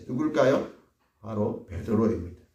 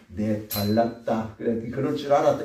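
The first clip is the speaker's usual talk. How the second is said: mock gloating; 3 semitones lower than usual. Preacher imagining Jesus gloating at Peter, smug.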